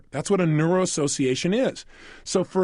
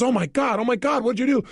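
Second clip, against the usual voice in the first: higher-pitched mock panic; retold freak-out; 6 semitones higher than usual. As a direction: act out her alarmed reaction, raised pitch. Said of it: "She immediately freaks out".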